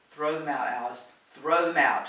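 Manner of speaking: loud insistent repeat; yelled. A narrator's reading. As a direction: shout it out firmly. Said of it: "she yelled".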